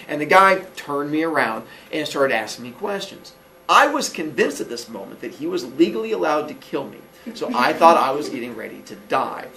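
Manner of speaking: normal talking voice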